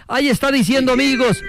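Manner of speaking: ordinary talk, nothing marked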